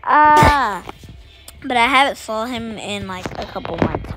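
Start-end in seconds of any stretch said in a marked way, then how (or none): 0.0-0.8 loud, high gasp of wonder; amazed ah. Retold or imitated. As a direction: let out an astonished ah. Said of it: "we was like".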